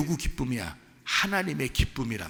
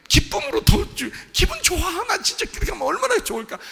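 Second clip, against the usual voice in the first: high, loud, gushing delight; overjoyed father; 4 dB louder than usual. Acted. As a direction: act out a father bursting with joy. Voices father's joy at being asked. (기뻐서 들뜬 아버지 연기, 높은 목소리)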